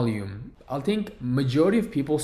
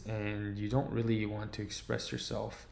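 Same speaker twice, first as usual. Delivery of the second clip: soft, unexpressive; 7 dB quieter than usual. Shown as a demonstration. Coach showing speech without body language, soft and unexpressive. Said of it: "you kind of sound like this".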